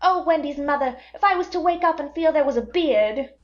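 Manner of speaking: Peter pushing away growing up; passionately. A narrator's reading. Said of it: "he told her passionately".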